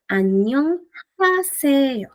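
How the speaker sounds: 안녕하세요 said syllable by syllable, slow and separated; read slowly. Shown as a demonstration. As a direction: spell out 안녕하세요 slowly, one syllable at a time. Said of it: "Si lo leemos lento". (안녕하세요 lento, sílaba por sílaba)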